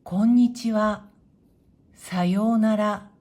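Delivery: こんにちは and さようなら said level and loud; clear, firm teacher demo of level こんにちは、さようなら; flat Japanese intonation. Shown as a demonstration. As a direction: give each mora of こんにちは and さようなら the same even pitch, no accented syllable. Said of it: "このように音も平らですし".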